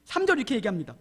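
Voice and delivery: usual speaking voice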